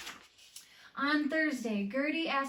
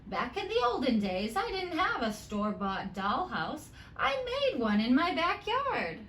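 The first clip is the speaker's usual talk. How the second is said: a hint of a fond grandma, a bit louder; grandma's advice; 6 semitones higher, 4 dB louder than usual. Grandma telling Molly about the olden days, lightly voiced. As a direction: lightly voice grandma passing on wisdom.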